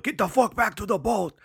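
put-on high, yelled Cajun accent; thick Cajun accent, urgent yell. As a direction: holler it like a Cajun boat captain. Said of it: "that's a pretty good Cajun accent".

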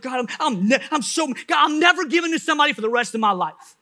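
high, stammering, worked-up protest; mad, arguing. Retold or imitated. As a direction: rant at God in comic outrage. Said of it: "I'm mad".

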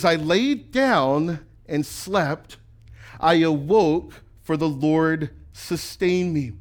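very slow, lingering verse read; slow, drawn-out reading of "I laid down and slept"; slow scripture reading. Shown as a demonstration. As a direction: savor every word of the verse. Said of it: "Keep reading with me".

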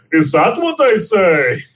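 put-on slow, lilting Irish accent on a villain's line; Irish accent Magneto. Quoting the villain's trailer line to cohosts, slow Irish accent. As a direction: drawl it like an Irish storyteller at the bar, slow. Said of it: "that was a very Irish, uh, accent you had there".

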